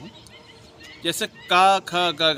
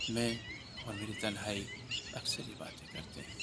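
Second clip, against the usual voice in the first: Amitabh Bachchan recitation; 3 semitones lower, 9 dB quieter than usual. Acted. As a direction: savour each pause like a lonely man. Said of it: "Amitabh Bachchan साहब का".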